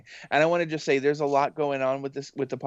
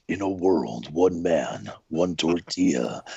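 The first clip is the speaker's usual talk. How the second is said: much lower epic movie-trailer voice in the style of Don LaFontaine; 7 semitones lower, slower than usual. Shown as a demonstration. Host demos a much lower epic movie-trailer voice. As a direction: boom it out low like a blockbuster trailer. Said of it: "that Don LaFontaine, like, epic movie trailer".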